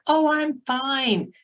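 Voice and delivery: high, slow fake-cheerful I'm fine; mock-cheerful denial to mom; falsely breezy. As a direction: brush it off in a high sing-song, pretending all is well. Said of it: "I'd be like".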